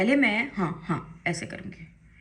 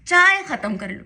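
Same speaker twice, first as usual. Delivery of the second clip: higher, louder auntie voice urging tea; imitating auntie; 7 semitones higher, 7 dB louder than usual. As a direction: play the fussing auntie. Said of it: "aunty कह रही".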